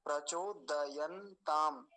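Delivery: प्रचोदयंताम very slow, द and य kept full; syllable-by-syllable प्रचोदयंताम, every consonant whole; careful pronunciation. Shown as a demonstration. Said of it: "दकार को और यकार को पूरा रखेंगे".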